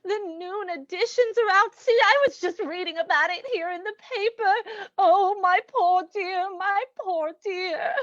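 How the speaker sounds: fussy, weepy sympathy; lamenting through tears; tearful condolence. Acted. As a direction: cry over the poor dear as you talk. Weeping condolence to bereaved niece.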